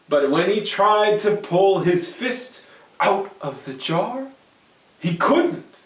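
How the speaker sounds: ordinary delivery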